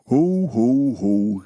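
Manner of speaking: loud Santa ho ho ho said flat, not laughed; spoken ho ho ho. Acted. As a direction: speak the hos, don't laugh them. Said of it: "Not a laugh, he just spoke the hose".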